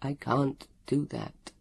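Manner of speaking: small, soft, slow helpless voice; pathetic. A narrator's reading. Captive Leon refusing Elio, pathetically. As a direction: say it feebly and haltingly. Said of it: "Leon's voice said pathetically".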